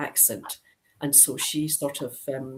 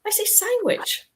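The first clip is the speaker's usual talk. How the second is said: much higher, "sandwich" said with a clean ch; playful, much higher "I say sandwich"; mock high insistence. Shown as a demonstration. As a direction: squeak it up in playful protest. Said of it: "nobody says sandwich".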